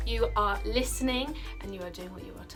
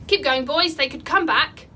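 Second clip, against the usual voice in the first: loud, higher-pitched rallying shout; urgent call; 5 semitones higher, 9 dB louder than usual. A narrator's reading. Gobber urging the boys onward, loud urgent call. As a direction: call out to hurry the group along.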